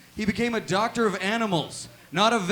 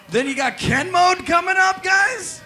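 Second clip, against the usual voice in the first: louder, rising to a high yelled guys; hype call; 8 semitones higher, 6 dB louder than usual. Real, not acted. Comic hyping Ken Mode to the crowd, loud rising call. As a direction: pump up the crowd.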